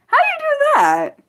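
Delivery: high incredulous squeak; shocked disbelief. Real, not acted. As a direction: pitch leaps way up in stunned disbelief. Shocked reaction to listener's story.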